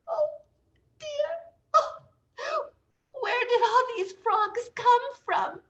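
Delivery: very high, dazed surprise; bewildered. Acted. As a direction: sound lost and startled. Dazed Janice returns.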